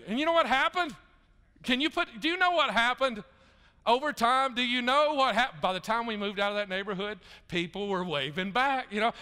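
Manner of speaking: loud, high punchline build; full-force preaching. Acted. Pastor telling the congregation about his neighborhood, loud preaching climax. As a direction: preach the payoff, rallying the room.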